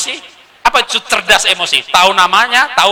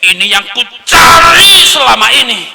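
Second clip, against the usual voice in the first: put-on excited child; 8 semitones higher, 9 dB louder than usual. Child grabbing the gadget.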